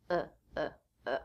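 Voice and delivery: "uh" as a short relaxed schwa; just a quick neutral "uh"; schwa vowel. Shown as a demonstration. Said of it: "it will often reduce to the schwa vowel".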